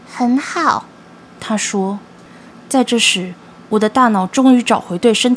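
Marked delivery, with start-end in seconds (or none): 0.1-0.8 pleased hissing creature. Acted. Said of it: "然后发出了愉悦的嘶嘶声".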